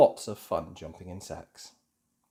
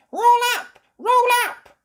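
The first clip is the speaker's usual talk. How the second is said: very high, loud stall cry; calling out; 12 dB louder than usual. A narrator's reading. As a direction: call shoppers over. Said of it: "she calls".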